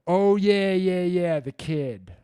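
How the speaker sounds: loud, high "oh yeah" as it clicks; sudden recall. Real, not acted. Remembering which viewer he was answering, loud and sudden. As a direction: blurt it out as the memory returns.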